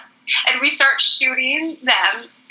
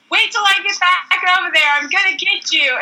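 a touch higher playful threat; mock threat; 8 semitones higher than usual. [name] warning the girls, playful mock threat. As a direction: tease a revenge promise.

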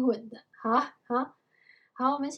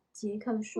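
usual speaking voice

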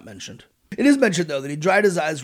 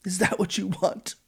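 higher, slow guilt trip; dramatic; 4 semitones higher, slower than usual. Retold or imitated. Old father Jacob guilt-trips his sons, slow and hammed-up. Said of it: "he continued".

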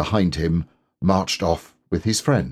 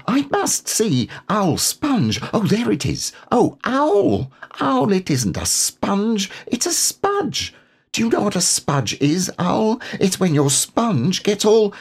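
put-on eager small child piping up; little Roo; 14 semitones higher than usual. Roo chattering excitedly to Owl about the spudge.

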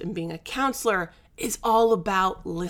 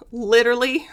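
laughing; 3 semitones higher, 7 dB louder, faster than usual. Real, not acted. Laughing repeat.

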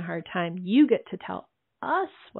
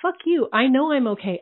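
higher, louder, acted angry comeback; pissed-off retort; 6 semitones higher, 5 dB louder than usual. Crying woman answers people shushing her, angry retort. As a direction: fire back at the one shushing you. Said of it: "I'm just really fucking pissed off".

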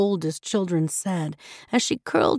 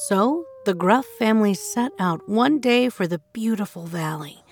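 plain reading voice

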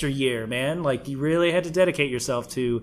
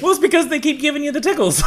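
much higher, fast put-on voice; high, fast silly voice; mock silly voice; 12 semitones higher, 4 dB louder, faster than usual. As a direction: squeal it out as a goofy tease. Teasing in mock voice.

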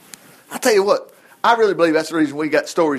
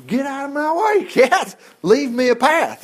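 shooing the cat; 7 semitones higher than usual. Retold or imitated. Preacher shooing his cat aside.

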